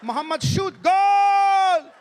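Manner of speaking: sudden high, loud goal scream; commentator goal yell. Retold or imitated. Mock commentator bursting out on the goal, yelling. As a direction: explode on goal. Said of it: "The commentary goes".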